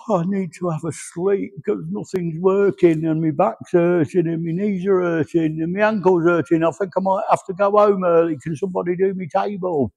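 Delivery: high, put-upon moaning about every ache; whiny complaining. Retold or imitated. Mimicking his wife moaning she needs a sleep, high and whiny. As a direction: whinge it out like she's exhausted and falling apart. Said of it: "she'll get there and go".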